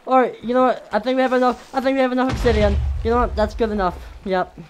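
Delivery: high, loud, hurried talk; panicked rush. Real, not acted. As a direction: babble in a hurry to flee. Panicking, decides to leave.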